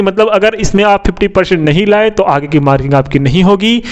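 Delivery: normal talking voice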